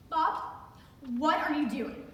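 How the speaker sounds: acted mild alarm; alarmed protest. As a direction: challenge him in startled disbelief. Alarmed woman being undressed.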